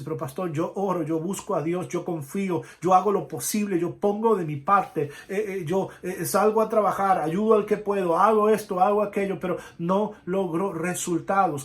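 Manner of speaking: loud, pleading complaint of a tired believer; frustrated believer. Acted. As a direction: vent your frustration to the pastor. Congregant complaining to pastor. (creyente frustrado que se queja al pastor en voz alta)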